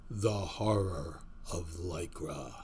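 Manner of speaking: low, slow, ominous horror voice; horror host. Acted. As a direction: intone the title like a horror trailer. Horror-style show title intro.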